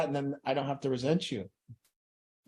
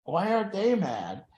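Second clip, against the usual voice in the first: puzzled wondering; 6 semitones higher, 4 dB louder, slower than usual. Retold or imitated. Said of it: "And I always thought".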